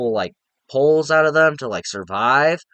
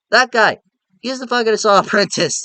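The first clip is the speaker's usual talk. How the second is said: higher and louder, sure of the guess; excited realization; 8 semitones higher, 4 dB louder than usual. Retold or imitated. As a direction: blurt it out like you cracked the case. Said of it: "I was like".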